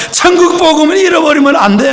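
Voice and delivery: a daughter's crying insistence on 천국; urgent tearful plea. Retold or imitated. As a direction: plead again, pressing hard on 천국. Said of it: "딸이 막 울면서".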